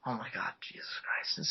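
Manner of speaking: whispered, low aside; hushed dread. Real, not acted. Teller braces for the painful scene, hushed. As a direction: murmur it under your breath in dismay.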